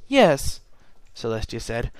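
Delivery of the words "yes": determined. Acted. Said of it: "frowning in determination".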